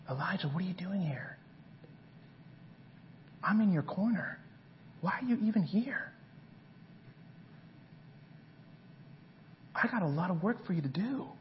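God gently calling Elijah back. Acted. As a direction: speak close, urging him gently. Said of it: "And you continue reading the story".

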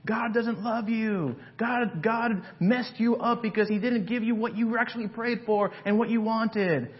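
Satan jeering at the wanderer; Satan taunting. Acted. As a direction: sneer it like the accuser. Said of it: "Satan may be yelling".

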